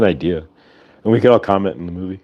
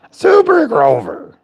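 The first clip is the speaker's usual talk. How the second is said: very high, slow Muppet Grover cry; 14 semitones higher, much slower than usual. Retold or imitated. Reminding friends of Super Grover, high slow Muppet cry. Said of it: "Remember Super Grover?".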